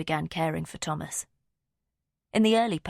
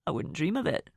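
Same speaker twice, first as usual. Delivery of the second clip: lightly voiced Scottish man. A narrator's reading. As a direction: humour him in a light Scottish accent. Finn's voice, dry reply.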